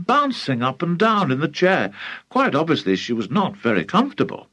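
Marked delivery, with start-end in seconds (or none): none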